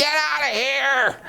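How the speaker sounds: high, slow hammed-up shriek through a laugh; mock yell. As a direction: play the furious wife, laughing. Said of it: "the wife is screaming".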